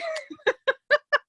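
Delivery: high, tearful giggle; teary laugh. Real, not acted. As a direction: laugh through the tears. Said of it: "teary laugh after crying remark".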